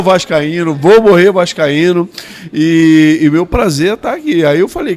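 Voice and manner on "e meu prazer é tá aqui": ordinary talk, nothing marked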